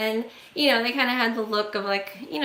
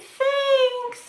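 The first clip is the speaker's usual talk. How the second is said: much higher, sing-song polite brush-off; mock sweet thanks. Woman turning round to the stranger, mock sweet thanks. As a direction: chirp it falsely polite.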